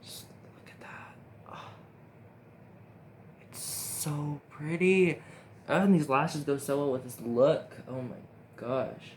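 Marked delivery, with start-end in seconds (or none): 0.0-1.7 breathless whisper of delight; hushed, admiring whisper; awed whisper. Real, not acted. 3.4-5.2 slow admiring. Real, not acted. Admiring glow.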